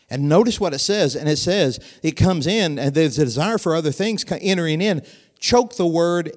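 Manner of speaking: loud, driving sermon delivery; preaching. Acted. Preacher pressing the congregation, loud driving sermon.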